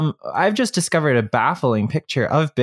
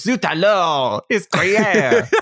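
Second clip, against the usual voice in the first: slow, higher mock French exclamation; French accent; 7 semitones higher, much slower than usual. Silent dwarf finally speaks, tasting cheese, mock French accent. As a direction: camp it up like a secretly French dwarf. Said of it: "you find out he was French".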